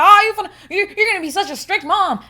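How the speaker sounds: loud, high mock outcry; scolding friends. Friends objecting to her strict parenting plans, mockingly loud. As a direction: imitate friends protesting loudly. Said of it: "They're like".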